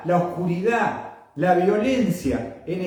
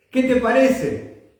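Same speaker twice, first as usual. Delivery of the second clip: raised, higher-pitched question to the congregation; insistent repeat; 5 semitones higher than usual. Real, not acted. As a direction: press the question on the listeners, pitched up. (pregunta insistente, en tono más alto)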